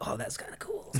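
very soft, airy murmur; faint dazed aside. Retold or imitated. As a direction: murmur it weakly under the breath. His dazed reaction after falling.

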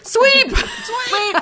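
high loud sweep call; curling shout. Pretend. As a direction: bark it like a curling skip. Acting out curling calls.

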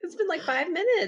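high-pitched mock disbelief; incredulous. Parent retelling a reply to her sobbing daughter, incredulous.

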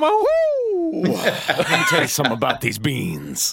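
hammed-up pro wrestler bellow, laughing; wrestling promo. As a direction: roar it like a wrestler grabbing the mic. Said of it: "Are you gonna cut a wrestling promo?".

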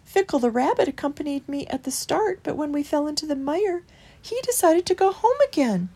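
high, mildly sorrowful voice; sad little mouse. A narrator's reading. Christopher telling Holgate of a deserter, high and plaintive.

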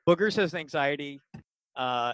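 ordinary talk, nothing marked

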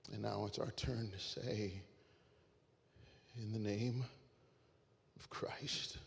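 quiet and moved; hushed emotional close. Real, not acted.

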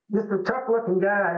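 normal talking voice

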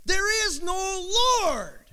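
high, slow mocking retort; atheist yelling back. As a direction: throw it back at her across the yard. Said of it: "yelled back".

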